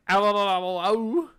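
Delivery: loud, high pained yelps. Acted. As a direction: yelp in pain. Hand squeezed painfully.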